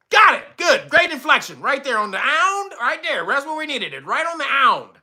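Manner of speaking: mock producer. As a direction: play the pushy producer. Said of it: "mock producer praise".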